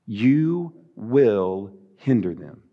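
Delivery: slow, low and tender; gentle but firm. Real, not acted. Pastor to moms and dads, slow and tender. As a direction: say it slowly with love. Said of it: "hear me with love".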